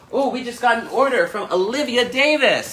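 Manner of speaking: high, excited order call-out; excited announcement. Real, not acted. Shopping host announcing a fresh order, excited. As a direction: announce the news high and thrilled.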